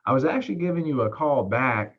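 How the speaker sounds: unhurried, slowed-down phone opener; slow delivery. Shown as a demonstration. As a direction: take your time, match a slow caller. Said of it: "You see how much slower that is?".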